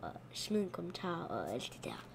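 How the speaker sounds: softly whispered aside. Shown as a demonstration. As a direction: whisper the request to viewers. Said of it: "whispered repeat".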